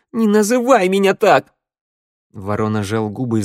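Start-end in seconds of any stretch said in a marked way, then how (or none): 0.1-1.5 high, loud snap; angry rebuff. A narrator's reading. Sanda rejects being called little bird, snapping angrily. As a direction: cut the pet name off angrily.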